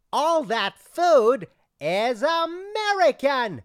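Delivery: outraged shout that the food is American; furious shout. Acted. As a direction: explode at him. Customer explodes at waiter.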